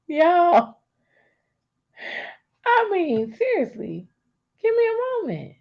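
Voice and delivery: high, laughing and overcome. Real, not acted. Laughing, overcome mid-talk.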